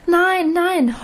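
high despairing wail on nein; desperate wail. A narrator's reading. Girl struggling as they untie her apron, wailing. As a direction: cry out in desperation. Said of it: "heulte ich verzweifelt".

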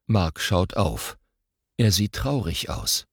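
plain reading voice

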